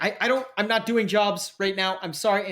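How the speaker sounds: high-pitched, stammering refusal; reluctant contact. Acted. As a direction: hastily beg off, apologising. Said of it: "And he's like".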